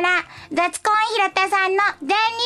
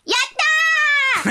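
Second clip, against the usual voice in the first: slow drawn-out joyful cheer, higher; cheering on request; 8 semitones higher, much slower than usual. Pretend. Host asked if she can just be happy, drawn-out cheer. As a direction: stretch out a big happy cheer. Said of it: "そのまま喜んだりできますか？".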